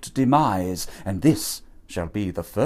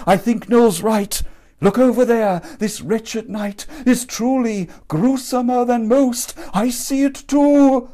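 higher and louder, alarmed and rushing; frightened; 11 semitones higher, 5 dB louder than usual. Acted. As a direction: Horatio sees the ghost and panics. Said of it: "he gasped".

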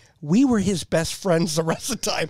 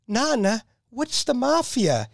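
put-on child voice; 8 semitones higher, slower than usual. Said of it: "he goes".

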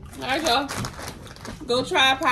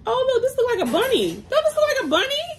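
excited; 12 semitones higher than usual. Real, not acted. Spots a bunny-shaped popcorn piece.

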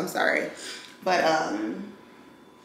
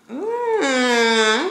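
slow, high, drawn-out coo; coo, pleasantly surprised; slow high coo of approval; impressed ooh; 5 semitones higher, much slower than usual. Real, not acted. Impressed by screen protector.

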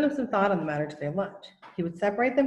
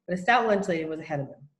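ordinary delivery, nothing marked

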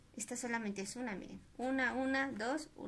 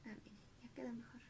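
whisper; 14 dB quieter, much faster than usual. Real, not acted. Fixing her drawing, aside.

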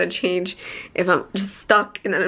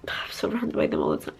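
hushed confession, whispered; 8 dB quieter than usual. Real, not acted. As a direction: whisper it so no one hears. Confiding about parents.

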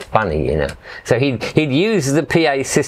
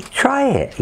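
much higher, coaxing voice; coaxing offer. Retold or imitated. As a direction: urge them to taste it, sing-song. Offering sandwich to Thai boys.

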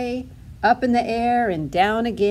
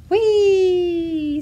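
high, loud, long whee; crying out gleefully; 10 semitones higher, 7 dB louder than usual. A narrator's reading. Hedgehog rolling downhill, gleeful cry. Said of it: "Spike cried".

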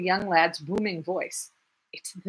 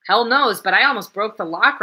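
gruff reply; 3 semitones higher, 8 dB louder than usual. Acted. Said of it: "replied Coke".

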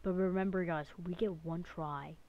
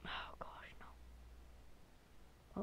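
whispering; 15 dB quieter, much slower than usual. Real, not acted. Quiet dismay in game.

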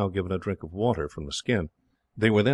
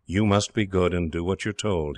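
usual speaking voice

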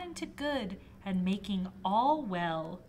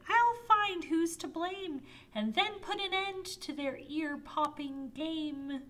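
eager knight voice; 8 semitones higher than usual. Acted. Brave knight reassures king.

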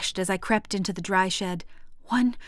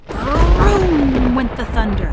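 loud, slow roar; booming narration; 4 semitones higher, 8 dB louder, slower than usual. A narrator's reading. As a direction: let the thunder roar through it. Dramatic thunder narration.

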